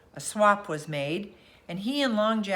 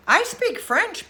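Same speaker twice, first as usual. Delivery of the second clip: put-on high, slow boy's eager pipe; a boy eagerly chiming in, high; piped up; 12 semitones higher, 7 dB louder, slower than usual. A narrator's reading. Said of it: "piped up Harvey".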